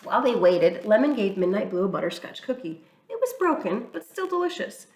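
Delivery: ordinary delivery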